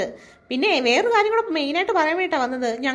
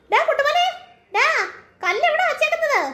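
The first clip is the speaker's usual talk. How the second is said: calling out; 7 semitones higher than usual. Acted. Calling the boy through window.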